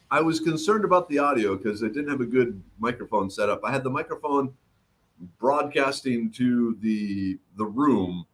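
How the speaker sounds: ordinary talk, nothing marked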